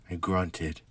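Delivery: usual speaking voice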